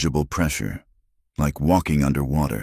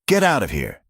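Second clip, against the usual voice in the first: a restrained yell to send them away; urgent yell; 7 semitones higher than usual. A narrator's reading. Rancher sending the Apache family to safety, restrained high yell. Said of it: "Cal yelled to the Apache man".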